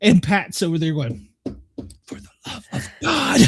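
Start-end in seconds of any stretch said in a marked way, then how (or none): 2.0-3.4 retold exasperation, lightly acted, pitched high; mock-exasperated old nurse; high, put-on groan of a fed-up colleague; salty old nurse. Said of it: "she's old, salty nurse".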